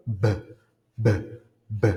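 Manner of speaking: B with lips and vibrating vocal cords; voiced B. Shown as a demonstration. Teacher contrasting B with P, separate voiced bursts on B. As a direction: sound the B with full voice, separately. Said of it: "et au niveau des cordes vocales".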